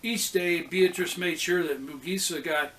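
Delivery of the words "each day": ordinary delivery, nothing marked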